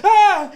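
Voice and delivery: very high, loud imitated scream; startled shriek imitated. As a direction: echo the customer's yelp. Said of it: "they'd be like".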